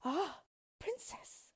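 high, slow, tearful mother's lament; weeping queen. A narrator's reading. Weeping queen laments to the princess, tearful and high. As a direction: sigh it out high, in acted distress.

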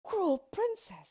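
high, loud, drawn-out accusation; anguished lover, loud and high; reproachful king. A narrator's reading. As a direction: slowly cry out your reproach. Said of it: "said the king".

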